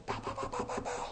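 tiny high, soft popping sounds; little rapid-fire pows, high and quiet; squeaky soft sound effect for a cartoon; imitated cartoon noise. Imitating cartoon toes starting to shake.

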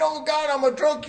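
earnest repentance cry. Retold or imitated. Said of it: "you're repenting and you're saying".

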